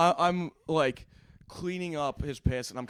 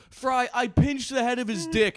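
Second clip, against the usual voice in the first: high slow voice; 9 semitones higher, slower than usual. Retold or imitated. Retold confession, high and slow.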